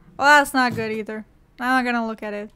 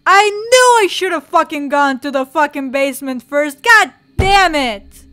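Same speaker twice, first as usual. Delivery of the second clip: loud frustrated yell; furious outburst; 3 semitones higher, 12 dB louder than usual. Real, not acted. Player cursing over not going to the basement first, furious. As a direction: blow up in anger at yourself. Furious at own mistake in game.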